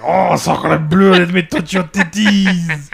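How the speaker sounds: slow, hammed-up French accent; mock French accent. Host voicing the French lover from a joke, mock French accent. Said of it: "like, in French".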